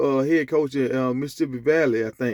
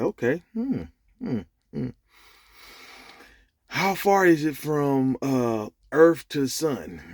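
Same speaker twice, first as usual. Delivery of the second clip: slow, lightly acted mulling over a question; pondering; slower than usual. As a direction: imitate someone slowly thinking up a question. Joke character ponders a hard question.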